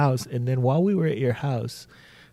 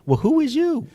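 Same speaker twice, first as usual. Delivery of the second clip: high mock voice; 5 dB louder than usual. Acting out the exchange.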